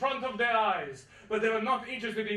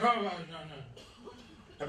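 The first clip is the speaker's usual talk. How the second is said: low, slow, soft grumble; mock grumbling; 4 semitones lower, 5 dB quieter, much slower than usual. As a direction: grumble to yourself over a dirty window. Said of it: "you grumble. You're like".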